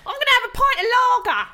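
high, loud cheeky cockney hello; loud cheeky Essex greeting. Pretend. Denise voiced in improv.

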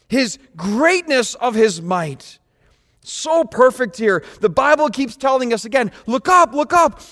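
high, loud fervent preaching; impassioned pulpit exhortation; full-force preaching. Real, not acted. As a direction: urge the crowd to lift their eyes.

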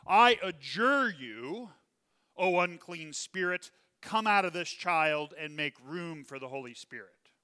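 slow, loud command to the spirit; commanding exorcism. Shown as a demonstration. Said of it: "here is the command".

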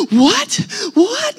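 high, loud, gasping disbelief; shocked. Retold or imitated. Wife learns who the celebrity is, shocked. Wife's acted shocked reaction.

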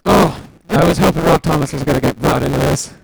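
loud, high burst of fan delight; excited outburst. Real, not acted. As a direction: blurt it out loudly, thrilled he's included. Delighted fan reacting to [name].

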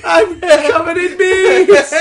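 high, loud, slow scream of panic; panicked shout. Acted. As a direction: shriek as if swarmed. Silly panicked joke voice.

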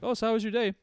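high, bright imitation of girlfriend; lightly voiced girlfriend asking. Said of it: "my girlfriend will be like".

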